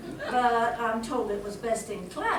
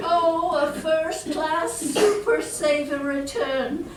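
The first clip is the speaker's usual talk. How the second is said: shrill camp pantomime dame; 6 semitones higher, 5 dB louder, slower than usual. Acted. Conductor delighted by ticket.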